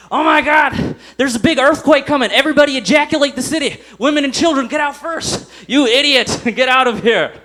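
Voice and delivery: high, loud panicked yelling; a hammed-up frantic alarm, shouting. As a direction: yell the evacuation in a frantic panic. Panicked earthquake alert acted.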